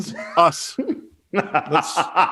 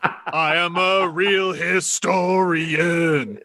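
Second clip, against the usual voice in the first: wrestling promo; slower than usual. Pretend. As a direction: growl it like a wrestler on the mic. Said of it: "Cut a promo on fake punch guy".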